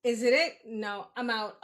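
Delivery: loud, curt, done-with-it; a punchy, loud brush-off. Retold or imitated. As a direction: bark out "Is it it? No, I'm out." as a cut-off.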